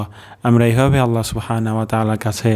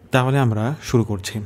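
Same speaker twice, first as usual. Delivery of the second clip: ordinary delivery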